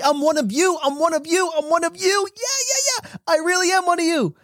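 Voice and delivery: put-on a kid bursting with joy to belong; excited child. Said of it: "tell everybody".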